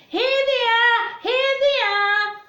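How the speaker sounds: high, loud and eager; a child proudly shouting his find; excited shout. Acted. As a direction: call out in triumph like a thrilled boy. Confident boy finds potatoes.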